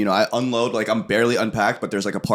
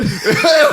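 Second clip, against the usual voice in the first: high burst of laughter; 7 dB louder than usual. Real, not acted. Laughs retelling the story.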